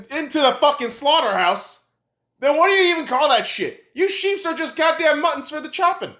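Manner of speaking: high-pitched, pressing indignation; heated rant. Real, not acted. Ranter scolds people willingly going to slaughter, heated rant.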